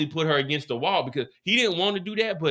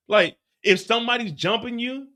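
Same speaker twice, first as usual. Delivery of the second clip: normal talking voice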